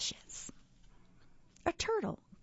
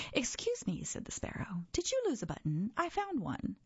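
much higher, quick little bird voice; chirpy sparrow; 6 semitones higher than usual. Acted. Sparrow offering the button, high and quick. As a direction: pipe it up high like a small bird.

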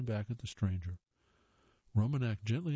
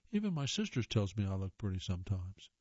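ordinary delivery

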